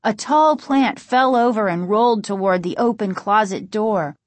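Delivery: ordinary delivery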